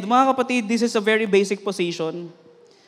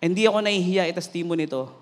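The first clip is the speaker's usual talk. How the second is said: usual speaking voice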